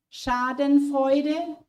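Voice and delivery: "Schadenfreude" said slowly and clearly, the German way; slow German word. Shown as a demonstration. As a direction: sound out "Schadenfreude" slowly for learners. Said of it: "your teachers would have used this word".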